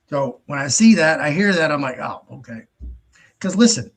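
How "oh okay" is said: quiet let-down oh okay; under the breath; soft deflated aside. Retold or imitated. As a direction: drop it to a murmur. Reacting with resignation.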